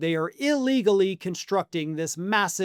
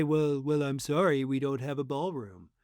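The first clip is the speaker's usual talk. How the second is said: softer, sheepish apology; 5 semitones lower, 7 dB quieter than usual. Pretend. Trump apologizing to Putin, softer and sheepish. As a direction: mumble it out sheepishly, embarrassed. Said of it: "Trump has to sheepishly say".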